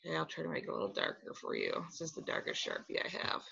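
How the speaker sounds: quiet muttered aside; muttering to self. Real, not acted. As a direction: mutter while drawing. Drawing with marker, muttering.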